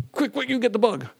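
put-on high, urgent little voice of the kids; kids calling for help. Sisters calling their brother to kill a bug, high and urgent. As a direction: hurry him over to squash it.

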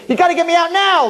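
high, loud, pleading cry; desperate plea. Acted. Jailed father begging the lawyer, loud and pleading. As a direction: beg to be let out, voice climbing.

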